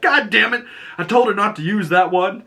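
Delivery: high, exasperated imitated groan of a line; mock frustrated hero. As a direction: play a fed-up goofball hero. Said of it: "he's like".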